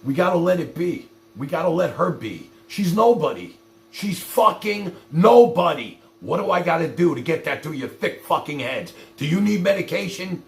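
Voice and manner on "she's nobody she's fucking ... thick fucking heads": normal talking voice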